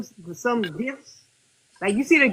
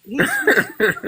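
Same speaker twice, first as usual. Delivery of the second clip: laughing; slower than usual. Real, not acted. Laughing at the gift mix-up.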